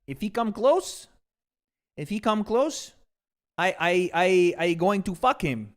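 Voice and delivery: slow, halting threat in broken English; Canelo impression. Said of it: "That's what he said about Oscar".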